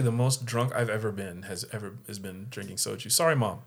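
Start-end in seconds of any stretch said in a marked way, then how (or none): none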